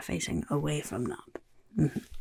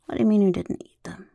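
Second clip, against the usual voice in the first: louder, fast incredulous outburst; shocked disbelief; 2 semitones higher, 7 dB louder, much faster than usual. Acted. Goblin boss appalled the recruit didn't eat the catch, loud and fast. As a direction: blurt it out, appalled.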